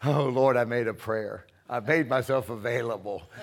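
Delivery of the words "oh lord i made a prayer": ordinary talk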